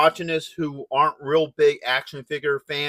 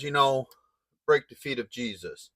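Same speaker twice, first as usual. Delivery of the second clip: normal talking voice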